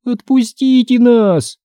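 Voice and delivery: high, drawn-out whine; voiced whiny girl; whining plea. A narrator's reading. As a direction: whine to be let go, slow and high. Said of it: "заныла Эмили".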